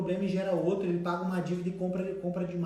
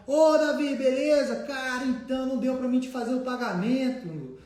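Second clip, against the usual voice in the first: higher, louder mock cheery greeting; breezy debtor excuse; 6 semitones higher, 4 dB louder than usual. Debtor phoning to dodge the payment, higher and louder mock cheery voice. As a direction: sell the excuse like a pal.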